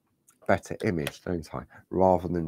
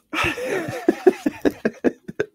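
high nervous laugh. Real, not acted. Nervous after saying scared.

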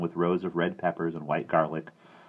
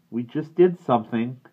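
higher, louder bitter retort; 7 semitones higher, 8 dB louder than usual. A narrator's reading. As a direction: throw it back bitterly. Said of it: "Denny's was bitter".

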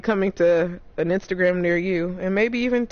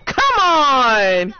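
shouting; 4 semitones higher, 6 dB louder than usual. Real, not acted. Urging teammate in fight.

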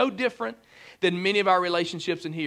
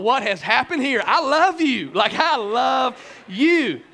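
laughing; 4 semitones higher, 5 dB louder, slower than usual. Retold or imitated. Boyfriend overwhelmed by love.

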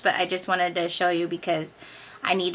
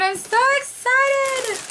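much higher, louder and drawn out; real thrilled squeal; squealing excitement; 5 dB louder, slower than usual. Real, not acted. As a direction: gush it like a delighted squeal. Thanking friend for gifts.

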